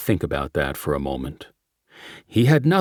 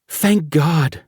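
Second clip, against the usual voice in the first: higher, relieved outburst; relieved exclamation; 8 semitones higher, 3 dB louder than usual. Acted. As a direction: blurt out relief. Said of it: "she exclaimed when she heard".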